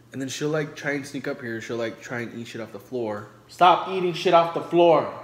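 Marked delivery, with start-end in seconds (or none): 3.5-5.1 loud, higher scolding yell; scolding shout. Retold or imitated. Vlogger shows how she yells at the dog for eating off the floor. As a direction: shout it like catching her in the act.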